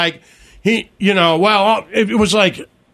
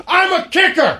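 mock kicker shout; 11 semitones higher than usual. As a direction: belt it out like a cocky kicker, laughing. Tucker voiced mocking fans.

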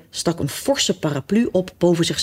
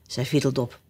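usual speaking voice

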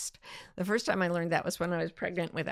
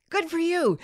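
usual speaking voice

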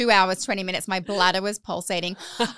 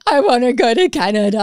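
put-on louder, whiny little boy voice; imitating toddler; 3 semitones higher, 9 dB louder than usual. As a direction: play the kid begging to go. Son keeps asking about Canada.

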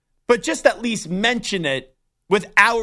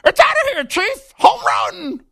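much higher, louder announcer yell; home run call; 5 dB louder, slower than usual. Retold or imitated. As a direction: scream it like the ball left the park. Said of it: "I wonder what his home run call is gonna be".

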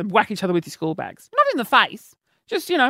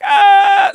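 high, loud hammed-up scream; mock scream; 11 semitones higher, 8 dB louder than usual. Child hit on the head, hammed-up scream. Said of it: "screaming and crying".

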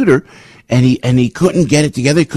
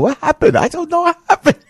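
much higher, put-on Chinese accent; mock Chinese accent; 13 semitones higher than usual. Host jokes about the stroke story, high mock Chinese accent. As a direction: squeak it out in a cartoon Chinese voice. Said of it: "I told Paul".

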